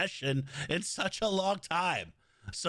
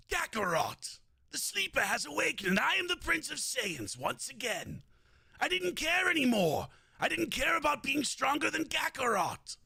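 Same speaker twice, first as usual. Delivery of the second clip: proud anime prince, raised pitch; Vegeta impression; 5 semitones higher than usual. Streamer performing Dragon Ball Z Vegeta for chat, haughty. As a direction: declare it with haughty pride. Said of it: "This is, like, old Vegeta".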